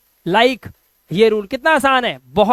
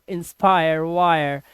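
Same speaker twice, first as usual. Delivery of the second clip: normal talking voice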